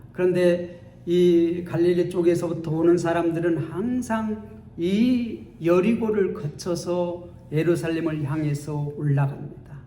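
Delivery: usual speaking voice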